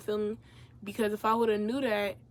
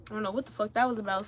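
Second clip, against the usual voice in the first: ordinary talk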